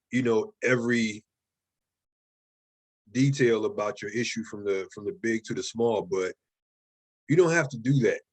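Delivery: usual speaking voice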